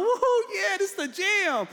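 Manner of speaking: high, loud mock fan whooping; excited fan. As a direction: whoop like a thrilled pop fan. Acts excited fan in bedroom.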